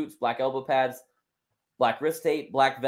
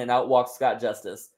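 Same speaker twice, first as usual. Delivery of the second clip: ordinary talk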